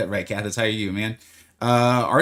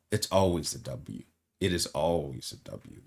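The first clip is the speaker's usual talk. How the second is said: low mock-serious; 7 semitones lower, 9 dB quieter than usual. Declaring Heimdall always a win.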